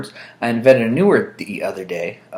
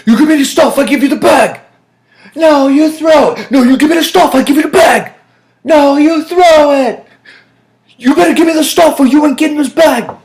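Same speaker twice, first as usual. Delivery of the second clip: high, full-volume movie-hostage-swap yelling; shouted standoff; 11 dB louder than usual. Acted. Acting out haggling with friend over stuff and bag, shouting. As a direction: holler the demand across a tense trade.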